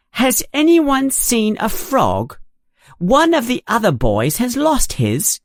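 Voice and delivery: high, pushy demanding question; bossy girl. A narrator's reading. Girl bursts in asking new classmates about a frog, bossy and high. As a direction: take charge of the room like a know-it-all. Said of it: "a very bossy voice".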